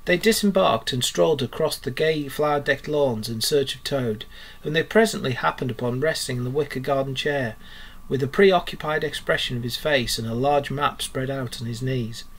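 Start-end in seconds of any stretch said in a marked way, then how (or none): none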